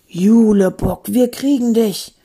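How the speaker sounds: loud, slow booming threat; ominous, booming warning; threatening. Acted. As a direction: draw the name out loudly with menace. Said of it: "drohte die Stimme".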